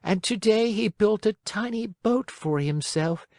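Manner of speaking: put-on high-pitched old woman telling sad news; little old woman. Old woman tells Pinocchio of a lost father, high sorrowful voice.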